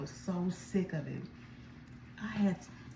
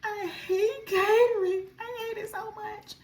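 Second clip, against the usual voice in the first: high, slow, tearful wail, imitating herself crying; retold crying; 14 semitones higher, 4 dB louder, slower than usual. Alone in the kitchen complaining to the Lord, crying high wail. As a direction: replay the sobbing breakdown over catering. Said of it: "I'm in the kitchen crying".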